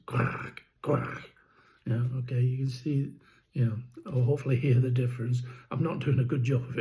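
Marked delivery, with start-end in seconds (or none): 0.1-1.3 mild raspy drake quack imitation; a hint of rattle, like a drake. Shown as a demonstration. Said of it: "and the drake is".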